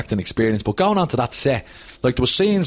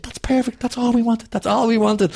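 pleased director; 11 semitones higher than usual. Retold or imitated. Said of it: "he said".

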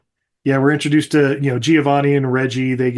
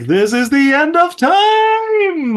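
long high ecstatic mock yell; stoked, stretched-out holler; super stoked; 13 semitones higher, 4 dB louder, much slower than usual. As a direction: draw it out high and loud, hammed-up elation. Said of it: "he's super fucking stoked".

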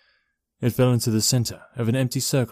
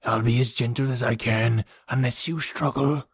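possessed man; 3 semitones higher than usual. Acted. Possessed Jim threatens.

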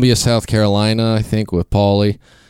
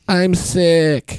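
high, whiny put-on voice; mock pitiful I'm sick; sick stripper; 10 semitones higher than usual. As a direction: play the queasy girl, pitched up. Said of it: "she's like".

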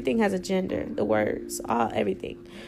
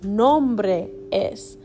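very slow, each syllable drawn out; slow demo; much slower than usual. Shown as a demonstration. Teacher slowly modelling "Mi nombre es" for students. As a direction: spell it out slowly for the learner. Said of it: "you could say".

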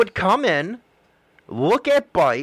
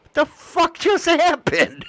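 mock baffled exclaim; 8 semitones higher than usual. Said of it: "I'm sitting here".

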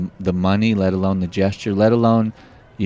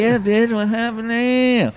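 mocking taunt; 13 semitones higher than usual. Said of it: "I'm like".

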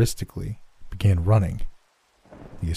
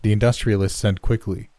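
usual speaking voice

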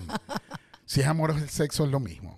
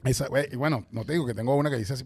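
ordinary talk

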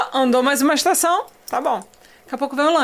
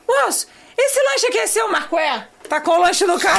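lightly acted attendant calling out; raised-pitch query to a customer; a hint of an insistent server; 6 semitones higher than usual. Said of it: "me aparece a atendente aqui assim".

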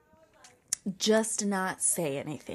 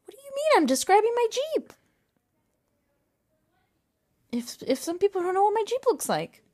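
very high, incredulous defence; squeaky-high mock offence; indignant protest; 11 semitones higher, 3 dB louder than usual. Real, not acted. Protesting partner's teasing about Jeep.

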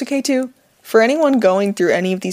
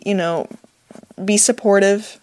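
ordinary delivery

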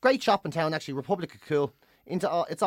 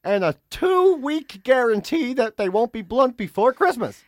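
loud, high hard-sell TV pitch; over-the-top infomercial parody; infomercial announcer; 5 semitones higher, 4 dB louder, slower than usual. Acted. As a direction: stretch out each offer like a shopping-channel host. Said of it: "infomercials. Where it's like".

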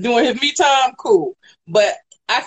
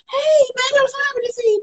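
high, sweet put-on greeting; gushing welcome. Woman mimics a partner greeting someone home, gushing and high-pitched. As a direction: squeal it like an overjoyed partner. Acting out a happy greeting at door.

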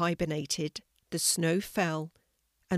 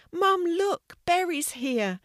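put-on much higher and louder child's call; excited child's shout; 11 semitones higher, 5 dB louder than usual. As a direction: call out to mum across the garden. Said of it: "shouted the little girl".

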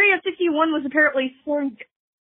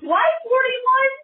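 incredulous; 9 semitones higher, slower than usual. Real, not acted. Incredulous about turtle count.

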